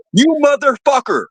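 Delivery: imitating a pissed-off guy's yell; angry stranger cursing the prankster; mock angry yell. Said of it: "he's yelling at me".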